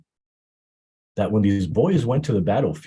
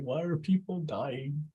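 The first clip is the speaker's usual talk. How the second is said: angry scolding mother; 9 semitones higher than usual. Retold or imitated. Bibi Zainab scolding her sons in a high, anguished voice. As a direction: demand of the boys why they are still here. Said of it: "she was angry at them".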